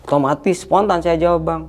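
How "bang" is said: plain reading voice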